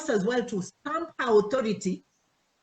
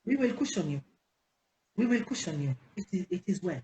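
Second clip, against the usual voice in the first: mock consolation fading; 3 semitones lower than usual. Old members consoling the newcomer, mock reassuring and fading. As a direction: soothe the newcomer, fading away.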